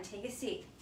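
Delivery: usual speaking voice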